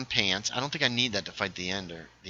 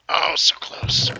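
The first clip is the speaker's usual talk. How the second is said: disappointed groan; 6 semitones higher, 6 dB louder, much slower than usual. Real, not acted. Near level thirty.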